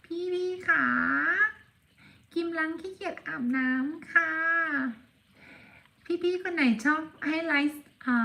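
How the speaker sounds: put-on high, childlike voice speaking as the cat; cute cat voice. Owner speaking as her cat to viewers, high cute voice. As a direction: play the lazy pet cat talking to viewers.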